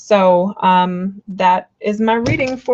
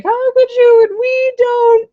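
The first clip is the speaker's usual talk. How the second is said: mock melodrama; 6 dB louder, slower than usual. Mocking overly emotional tarot cards.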